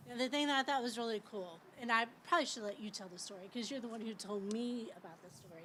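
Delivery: ordinary delivery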